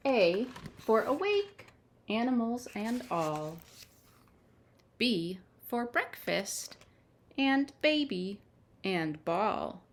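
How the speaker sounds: bright, lilting picture-book reading; reading to children. Acted. Storyteller reads the alphabet book to children, lilting. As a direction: read each letter like a treat for little ones.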